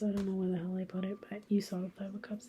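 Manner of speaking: hushed aside; whispered aside. Real, not acted. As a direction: mutter to yourself while searching. Muttering aside searching cards.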